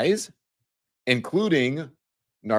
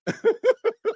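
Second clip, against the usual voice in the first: squealing giggle, far higher; high shrieking laugh. Real, not acted. Host cracking up at list.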